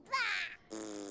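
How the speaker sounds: short high burst of laughter; a quick delighted laugh. Real, not acted. Laughs at funny faces.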